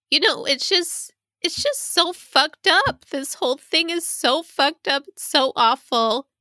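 tearful distress. Real, not acted. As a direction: let real tears push the voice up. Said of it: "And now I'm crying".

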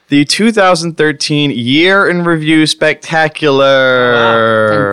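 slow, big showman announcement; announcer voice. Acted. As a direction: announce it like a game show host. Grand show title announcement.

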